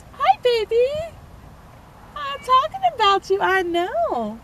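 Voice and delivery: high, cooing voice to a cat. Real, not acted. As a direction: coo to the cat in a high little voice. Talking to her cat present.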